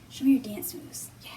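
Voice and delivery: normal talking voice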